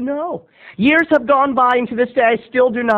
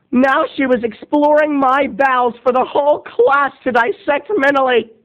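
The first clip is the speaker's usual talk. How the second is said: ordinary talk, nothing marked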